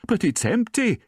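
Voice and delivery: high, loud, alarmed housekeeper; alarmed cry. A narrator's reading. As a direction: cry it out in dismay. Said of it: "she cried".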